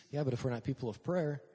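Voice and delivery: ordinary delivery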